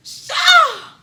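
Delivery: sudden very loud, very high yell; shocked shout. Real, not acted. Reacting to her own story, a very loud shocked yell. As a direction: blurt it out in disbelief.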